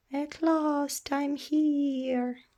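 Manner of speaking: put-on high, slow baby dragon voice; newborn baby dragon. Baby dragon emerging from its egg, slow and high. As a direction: stretch out, newly hatched.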